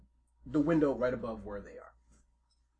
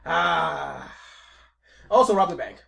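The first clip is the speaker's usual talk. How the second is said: a lightly voiced mafia boss bragging, louder; boastful mafia boss; 5 semitones higher, 10 dB louder than usual. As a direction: brag about the heist like a proud gangster.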